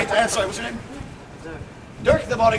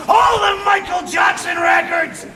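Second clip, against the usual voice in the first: comic shouted punchline; 7 semitones higher, 6 dB louder, slower than usual. Acted. Comic build of list.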